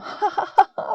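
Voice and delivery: a quick high laugh; demo laugh. Shown as a demonstration. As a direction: laugh as if it's really funny. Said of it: "That's so funny".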